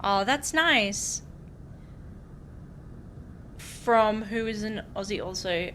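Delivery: ordinary delivery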